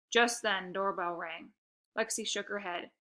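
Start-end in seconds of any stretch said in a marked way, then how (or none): none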